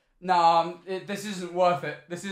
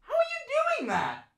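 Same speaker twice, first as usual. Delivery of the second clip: much higher, awestruck question; high amazed squeal; 3 dB quieter than usual. Real, not acted. Trying the walk, amazed.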